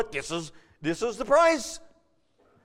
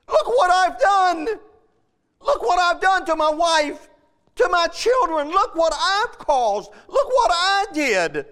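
weeping servant; 5 semitones higher than usual. Acted. Preacher voicing the ruined servant before his lord, weeping plea. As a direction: cry out his regret as a broken servant. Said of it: "Don't you think he was weeping?".

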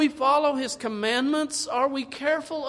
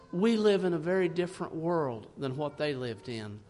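ordinary delivery

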